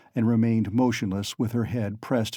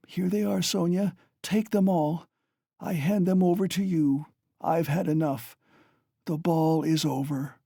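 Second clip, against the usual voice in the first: high, weary, bitter dying mother; Katerina, dying; 7 semitones higher than usual. A narrator's reading. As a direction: speak her sorrow in a raised strained voice.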